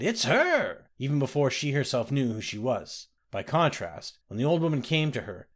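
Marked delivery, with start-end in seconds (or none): 0.0-0.9 startled cry. Acted. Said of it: "started and cried".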